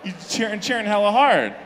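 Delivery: ordinary talk, nothing marked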